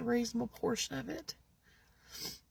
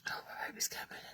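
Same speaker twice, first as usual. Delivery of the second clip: whisper; 13 dB quieter, much faster than usual. Real, not acted. Aside whispered.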